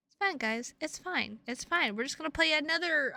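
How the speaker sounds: ordinary talk, nothing marked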